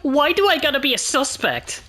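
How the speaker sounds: whiny killer voice. Acted. Genocide Jack protesting.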